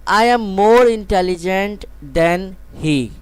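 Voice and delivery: very slow, clear classroom reading; slow example sentence. Shown as a demonstration. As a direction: read it slowly so students can write. Teacher reads example sentence.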